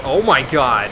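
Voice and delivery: loud startled shout; startled cry. Real, not acted. Player startled by a huge crow, shouting. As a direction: jump at the sight of it.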